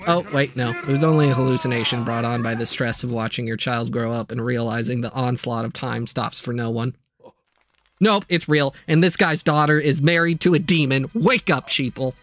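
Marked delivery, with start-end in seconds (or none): none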